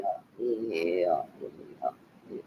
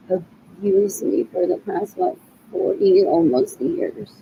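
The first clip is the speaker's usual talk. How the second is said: normal talking voice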